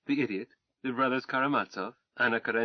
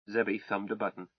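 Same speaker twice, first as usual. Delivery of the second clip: ordinary delivery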